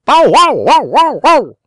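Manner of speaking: high, loud yapping bow-wows; real watchdog barking. Acted. Pinocchio barking to wake the farmer, loud high yaps. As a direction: bark it out like a proud guard dog. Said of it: "he barked as if he were a real watchdog".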